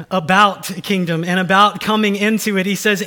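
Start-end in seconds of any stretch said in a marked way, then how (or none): none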